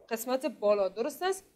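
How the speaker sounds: ordinary delivery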